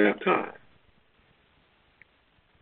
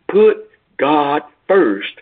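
very slow, each word weighed; preacher's slow refrain, Put God first; slow emphatic refrain; 5 semitones higher, 3 dB louder, much slower than usual. Acted.

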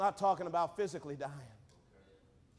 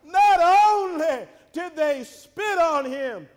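loud, high sermon proclamation; preaching cry; 9 semitones higher, 12 dB louder, slower than usual. Real, not acted. Preacher recounts the abuse of Christ, crying out. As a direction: throw it out to the whole congregation.

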